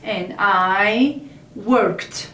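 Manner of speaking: each word drawn out slowly; slow demo. Shown as a demonstration. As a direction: spell it out slowly for learners. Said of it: "Okay, so this is for".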